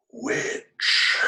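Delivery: hoarse, drawn-out whisper. Acted. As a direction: whisper it as a dying man's secret. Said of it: "he lowers his voice as much as he's able, and he mutters".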